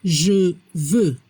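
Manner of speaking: "je veux" low and carefully separated, X silent; pronunciation model. Shown as a demonstration. Teacher showing Spanish speakers how French sounds, careful low "je veux" with silent X. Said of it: "La prononciación".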